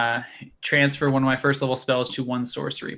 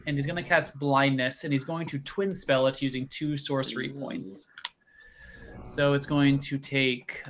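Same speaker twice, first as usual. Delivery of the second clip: ordinary delivery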